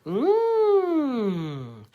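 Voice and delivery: high hum sliding down; humming. Shown as a demonstration. Coach demonstrates a vocal warm-up, high sliding hum. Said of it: "or humming".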